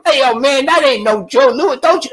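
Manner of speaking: high, loud protest from the hangers-on; correcting entourage. Retold or imitated. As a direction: jump in loudly to set him straight. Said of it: "the entourage started correcting him".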